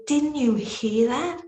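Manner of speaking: high, slowed, astonished question; can't believe she missed it; amazed. Retold or imitated. Group asking the leader, astonished and high.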